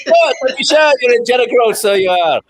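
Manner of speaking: playful stage Irish, giggling; mock Irish accent. Host teasing the co-host about being lost, in a laughing mock Irish accent. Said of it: "That was Irish".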